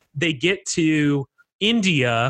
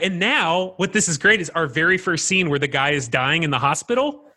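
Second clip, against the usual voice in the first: ordinary talk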